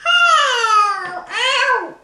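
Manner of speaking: loud pained cries; crying ow. Real, not acted. Child hurt while cooking, crying in pain. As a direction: wail out the hurt.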